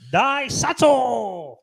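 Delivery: high, loud, stretched-out holler; drawn-out yell. Pretend. Yelling a car's name to his co-host, a long high holler. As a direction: bellow the name, dragging it out.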